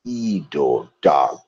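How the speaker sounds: low, slow growled name reveal; name said like an orc warlord; deep orc name. Shown as a demonstration. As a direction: drop low for the big name. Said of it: "I know him as".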